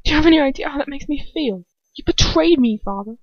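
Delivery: a son's wounded outburst at his father; hurt accusation. Acted. Draco confronts his father.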